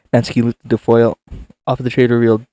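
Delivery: ordinary talk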